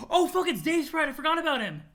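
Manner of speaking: high, loud burst of recognition; excited surprise. Acted. Spotting a forgotten friend, surprised outburst. Character suddenly spots Dave Sprite.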